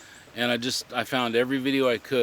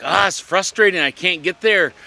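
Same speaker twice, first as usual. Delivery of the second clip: louder, higher frustrated outburst; 7 semitones higher, 8 dB louder than usual. Retold or imitated. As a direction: blurt it out, fed up and exasperated. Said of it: "and I just like".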